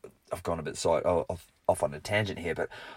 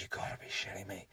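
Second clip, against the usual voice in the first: whispered disbelief; 17 dB quieter, faster than usual. Retold or imitated. Mortified muttered reaction.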